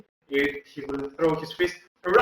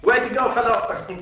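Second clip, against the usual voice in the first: cheering; 7 semitones higher than usual. Acted. Cheering a teammate's throw.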